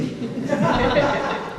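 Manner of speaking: a short high amused laugh. Real, not acted. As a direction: laugh at the audience. Laughing at audience's agreement.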